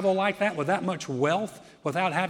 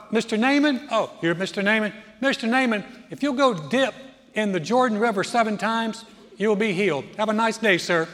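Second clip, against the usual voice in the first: high, loud, overly polite messenger voice; chirpy messenger; 5 semitones higher, 7 dB louder than usual. Acted. Messenger politely greets Naaman at the door, high and breezy. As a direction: play the cheerful intern brushing him off. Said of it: "so messenger comes out, goes".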